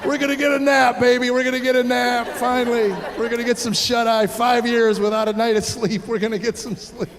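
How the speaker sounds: celebrating a nap at last; giddy relief. Retold or imitated. Parent gloating to wife in parking lot, giddy and gleeful.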